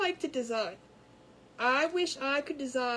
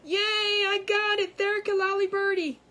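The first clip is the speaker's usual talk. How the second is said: excited cheer; 7 semitones higher, 7 dB louder than usual. Real, not acted. To the chat after getting the name right, triumphant. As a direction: crow with delight at getting it right.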